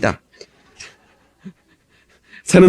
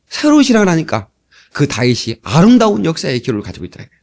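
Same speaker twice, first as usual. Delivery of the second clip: ordinary delivery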